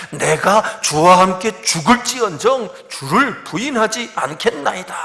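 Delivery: slow, high, booming declaration; hammed-up big talk, each phrase driven hard; loud boastful vow. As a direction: proclaim loyalty at full, overconfident volume. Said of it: "자기를 주장하며 큰 소리 칩니다".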